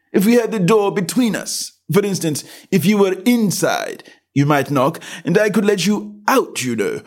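high, indifferent servant voice; a bored doorman brushing her off; dull footman. A narrator's reading. Footman explains.

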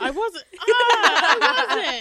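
loud, slow laughing protest; laughing denial. Real, not acted. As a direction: laugh while insisting. Denying dark humor, laughing.

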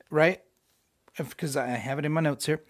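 normal talking voice

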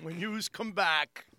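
slow Long Island "yous"; Long Island accent. Pretend. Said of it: "my thick Long Island accent".

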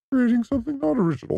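high, fast dopey voice; mock the simple-minded critic; mocking simpleton voice. Shown as a demonstration. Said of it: "the simple-minded can't understand the difference".

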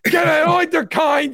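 high, loud hammed-up yell; mock crowd yelling someone out; mock screaming fans. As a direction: scream to throw him out. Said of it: "it would be screaming".

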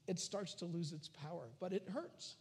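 ordinary talk, nothing marked

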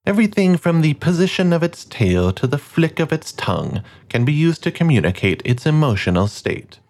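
slow, grand wildlife-film narrator; measured documentary voice over music; nature documentary narration. Acted. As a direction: read it like a nature documentary host.

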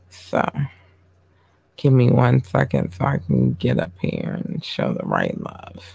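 normal talking voice

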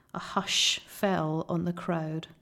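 usual speaking voice

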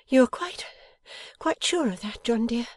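high, concerned wifely voice; high-voiced worried wife; anxious wife. A narrator's reading. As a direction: seek reassurance in a high, loving voice. Wife anxiously checks with husband.

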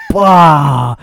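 loud, high "bah" for a crash; imitated smash noise. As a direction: burst it out as a shattering impact. Imitates a CD shattering on floor.

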